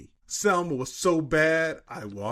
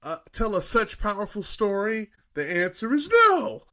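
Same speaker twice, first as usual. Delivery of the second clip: mock indignant rant; 7 semitones higher than usual. Reading the angry reviewer aloud to co-hosts, mock indignant. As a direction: play the reviewer's outrage for laughs, building to the end.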